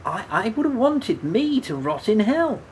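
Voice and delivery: high and loud, building; heated self-reproach. Acted. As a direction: burst out against yourself.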